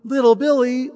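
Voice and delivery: a hint of Mommy calling her son. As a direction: call the boy sweetly as Mommy.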